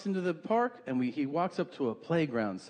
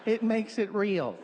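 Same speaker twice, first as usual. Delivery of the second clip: lightly voiced pleading, pitched up; mock plea in a higher voice; squeamish audience member; 7 semitones higher than usual.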